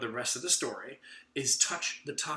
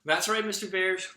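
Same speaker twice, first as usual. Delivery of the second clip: lightly voiced puppet bear, a bit louder; a cheery puppet bear answering back; playful bear puppet voice; 5 semitones higher, 6 dB louder than usual. Bear puppet answers the reader, playful.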